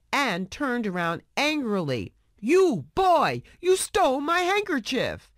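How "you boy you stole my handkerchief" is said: put-on high, loud bellow at the boy; robbed man bellowing at Oliver. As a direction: roar it out at the thief. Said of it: "he bellowed at Oliver".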